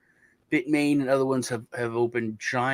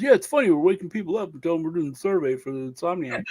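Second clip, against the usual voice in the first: imitating dumb friend; 5 semitones higher, faster than usual. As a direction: play the clueless buddy, lightly. Clueless friend explains himself.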